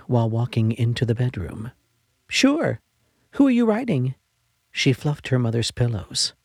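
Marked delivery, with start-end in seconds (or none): 2.3-4.1 put-on bright high child voice, pitched well up; young girl. A narrator's reading. Girl answers her sick mother, high child voice. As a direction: play an eager ten-year-old girl.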